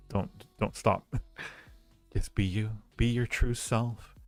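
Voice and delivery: normal talking voice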